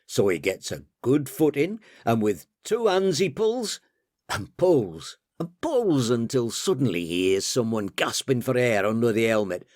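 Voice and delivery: usual speaking voice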